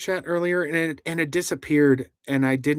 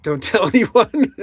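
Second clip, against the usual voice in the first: higher, louder, slowed by laughter; laughing; 5 semitones higher, 5 dB louder than usual. Real, not acted. Reading a viewer's French joke aloud, laughing. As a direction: read it out through a laugh. Jokey chat message read laughing.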